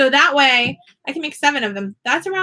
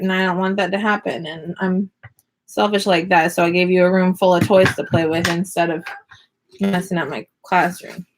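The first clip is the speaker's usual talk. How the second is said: ordinary talk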